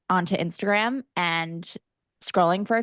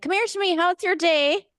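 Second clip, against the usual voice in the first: put-on high, chirpy Cork Irish accent; 11 semitones higher than usual. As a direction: greet your partner at the door in a Cork Irish lilt. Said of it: "I can hear myself being like".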